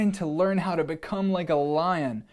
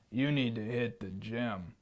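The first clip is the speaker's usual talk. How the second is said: lowered, softer lion; low lion voice; 6 semitones lower, 7 dB quieter than usual. Acted. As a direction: say it low and dry like a lion coach. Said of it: "said the lion".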